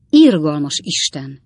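loud, drawn-out exclamation; exclaiming. A narrator's reading. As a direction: cry out slowly in dismay. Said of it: "kiáltott fel Pickwick úr".

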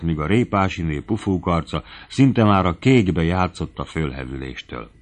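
plain reading voice

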